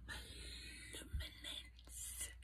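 luminance drawn out slowly in a whisper. Real, not acted. Showing off her luminance product in a slow whisper. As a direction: stretch out luminance, barely voiced.

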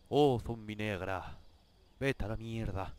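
slow, solemn mock poetry reading; mock poem recital. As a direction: declaim it like a bad poet, slowly. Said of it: "Voy a escribirle un poema". (recita como un poema burlón, despacio)

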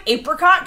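APRICOT with a long A as in ape; long-A pronunciation. Shown as a demonstration. Streamer rejecting the monkey-like version, apricot with long A. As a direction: make the start of "apricot" sound like APE. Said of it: "'cause an ape is a monkey".

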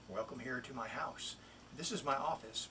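ordinary delivery

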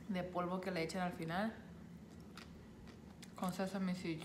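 talking between bites of food; eating salad while speaking; mouth full, chewing. Real, not acted. Seen 0: Bites salad with chopsticks at 5.0, chews and talks after.

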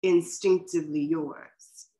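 slow, deliberate recital with long pauses; poetry reading. Acted. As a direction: savour each image, lines spaced apart. Reads another poet's poem.

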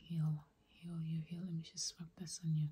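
ordinary talk

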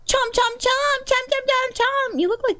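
high-pitched cutesy chomping chant; playful babble. Pretend. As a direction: chomp excitedly in high pet-talk. Plays with cat's name.

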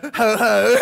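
mock jolly Santa laugh; a light hammed-up Father Christmas chuckle. Father Christmas pronouns joke.

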